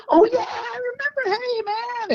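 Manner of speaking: high, put-on voice of a pal suddenly remembering; friendly guitarist. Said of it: "And he goes".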